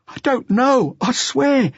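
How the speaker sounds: high, strained, scared denial; frightened denial. Acted. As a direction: plead innocence in panic. Terrified youth denying.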